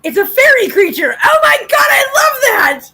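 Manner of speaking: loud, delighted and high; excited shout. Real, not acted. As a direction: squeal with joy at the news. Delighted by the fairy cat.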